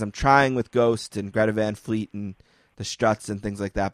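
ordinary talk

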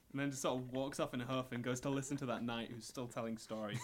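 normal talking voice

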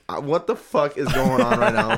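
slow, laughing "what is happening"; laughing disbelief. Retold or imitated. Stoned narrator to himself at the traffic stop, laughing. Stoned while cops search him.

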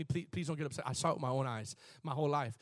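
usual speaking voice

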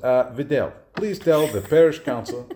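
lightly voiced priest, laughing; a hint of the old pastor; Father Boji impression. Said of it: "that impression of him".

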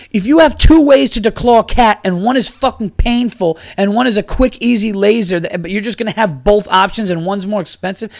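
outraged at the pricing; angry rant. Real, not acted. Podcaster ranting about cat declawing costs, exasperated.

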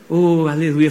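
ordinary talk, nothing marked

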